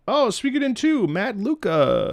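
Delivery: light up at a favorite song; excited recognition. Real, not acted. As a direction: gamer thrilled to hear the track. Excited as new track starts.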